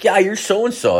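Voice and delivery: high-pitched imitation of a girl; lightly mimicked girl, pitched well up; girl's greeting. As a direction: act out her chirpy recognition. Voices girl approaching him.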